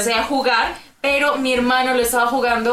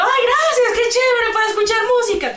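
high, louder fake-sweet thanks; mock gratitude; 12 semitones higher, 3 dB louder than usual. Girl thanking parents for a CD player, mock-sweet. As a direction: gush with sarcastic thanks.

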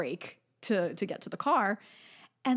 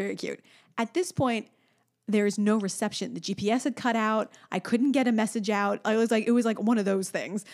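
ordinary talk, nothing marked

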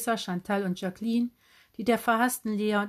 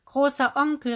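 higher, louder, sudden excited call; aufgeregter Ausruf; 6 semitones higher, 7 dB louder than usual. A narrator's reading. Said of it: "rief Tante Heidrun plötzlich aufgeregt". (aufgeregt gerufen)

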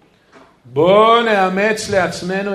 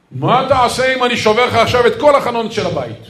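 loud, high retold threat; mock outburst, voice raised; angry threat; 6 semitones higher, 6 dB louder than usual. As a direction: imitate a tough man threatening the rabbi. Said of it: "התעצבן על הרב".

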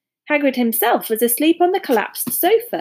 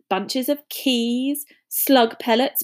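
ordinary delivery, nothing marked

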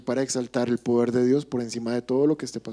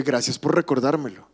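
ordinary talk

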